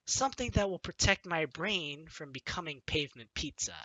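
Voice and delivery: ordinary delivery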